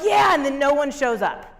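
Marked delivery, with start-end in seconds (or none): 0.0-0.3 mock eager yeah. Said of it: "and everyone's like".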